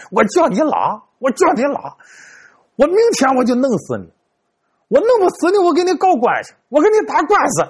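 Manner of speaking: put-on high, shrill-edged threats from the old man; an old man at his gate raging at the fortune teller; old man cursing. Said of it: "就听老头在那骂街".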